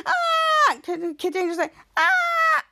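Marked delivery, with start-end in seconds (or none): 0.1-0.8 high, sustained mock scream; acted-out cry of fright; shocked scream. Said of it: "they were both being shocked". 2.0-2.6 high, held mock scream; acted startled cry; shocked scream. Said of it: "they were both being shocked".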